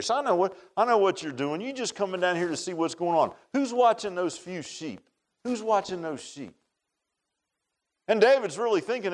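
normal talking voice

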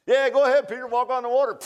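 high, loud egging on; God the Father cheering Peter out of the boat. Retold or imitated. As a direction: urge Peter on with gusto. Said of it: "Holy Spirit and God the Father".

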